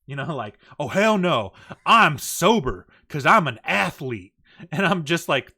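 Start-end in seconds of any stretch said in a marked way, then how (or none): 0.8-4.3 loud, slow, indignant retort; imitated affronted boast; offended stripper. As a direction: protest loudly, stretching the key words. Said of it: "she got offended".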